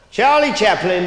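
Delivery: loud, grand doorman call; pompous announcer. Retold or imitated. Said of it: "and he's all pompous".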